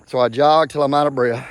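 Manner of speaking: normal talking voice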